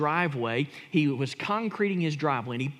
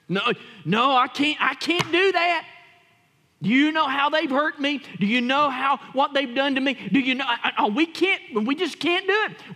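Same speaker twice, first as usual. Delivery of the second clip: higher, louder whiny protest; mock protesting believer; 7 semitones higher, 3 dB louder than usual. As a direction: play the hurt churchgoer refusing.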